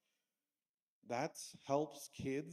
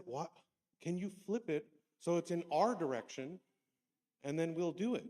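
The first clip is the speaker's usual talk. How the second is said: students asking; 5 semitones higher, 7 dB louder than usual. Retold or imitated. Students ask teacher.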